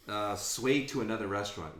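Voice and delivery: ordinary delivery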